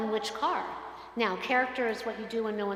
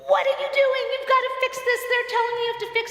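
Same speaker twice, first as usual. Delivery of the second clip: much higher, louder frantic yell, fast at the end; screaming at the dealer; 14 semitones higher, 6 dB louder, faster than usual. Retold or imitated. Pastor screaming at the car dealer, frantic shouting. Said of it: "as I'm screaming and yelling".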